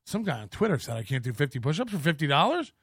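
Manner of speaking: soft, fast self-mocking aside; quiet, rushed pretend report; mock mumble. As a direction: mumble it to yourself while kneeling. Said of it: "Imagine me getting down".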